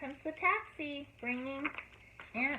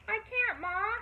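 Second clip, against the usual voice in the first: yelling back; 10 semitones higher, slower than usual. A narrator's reading. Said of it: "yelled Sam from the front porch".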